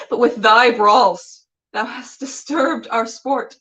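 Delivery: slow, measured recitation of verse; Shakespeare monologue. Acted. Host reading Titania's speech to viewers, measured and declamatory.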